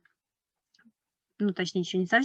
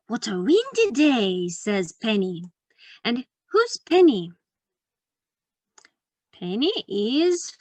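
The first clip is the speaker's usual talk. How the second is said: bright, slow picture-book reading; reading to a toddler; slower than usual. Acted. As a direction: read it to little kids. Reads picture book to children.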